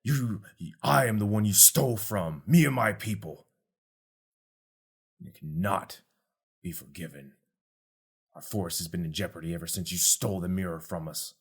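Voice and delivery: other-voiced grave accusation; a wronged forest creature condemning; vengeful creature Tuft.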